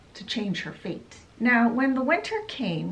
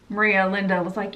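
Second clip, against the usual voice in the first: ordinary delivery, nothing marked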